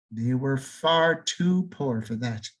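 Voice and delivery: ordinary delivery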